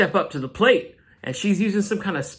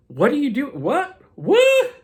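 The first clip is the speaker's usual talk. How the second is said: confused what; 6 semitones higher than usual. Retold or imitated. X-Men confront the Juggernaut.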